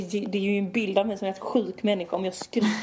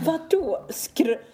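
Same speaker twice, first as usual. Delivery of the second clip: high, drawn-out indignant comeback; irritated retort; 8 semitones higher, much slower than usual. Retold or imitated. Retelling her reaction to being accused of bragging, irritated. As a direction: throw it back, offended and incredulous. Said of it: "jag blev så jävla irriterad".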